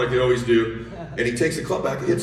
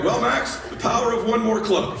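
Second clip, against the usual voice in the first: strained encouragement; 7 semitones higher than usual. Retold or imitated. Strained cheer after lost club.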